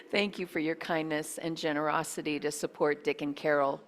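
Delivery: normal talking voice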